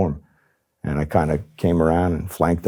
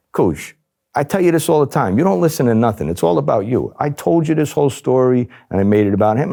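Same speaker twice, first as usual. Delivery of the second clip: exasperated; 8 semitones higher than usual. Retold or imitated. Spinning an exasperated cover story.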